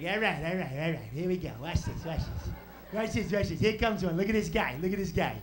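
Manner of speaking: put-on low, rapid-fire wiseguy kid; scheming little tough guy, low-pitched; Joe Pesci. Brother in the car spotting a target, Joe Pesci voice. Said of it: "He talked like Joe Pesci since I was seven".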